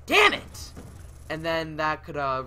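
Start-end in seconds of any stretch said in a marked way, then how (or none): 0.1-0.7 sudden high, loud curse; frustrated outburst. Real, not acted. Player cursing a sudden mishap in the game, frustrated outburst. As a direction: burst out at a real mishap in the game.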